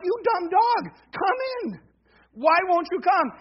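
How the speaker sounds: high, loud mock scolding of a dog; exasperated calling. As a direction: imitate a fed-up owner yelling at the dog.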